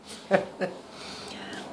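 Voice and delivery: breathy little laugh; soft laugh. Real, not acted. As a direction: laugh quietly at yourself. Laughs about her teeth.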